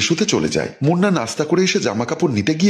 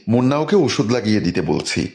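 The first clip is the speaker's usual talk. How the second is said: ordinary delivery, nothing marked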